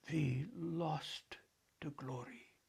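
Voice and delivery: quiet weighty echo; emphatic echo. Acted. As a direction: echo the boy to drive it home. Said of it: "the elder echoed for emphasis".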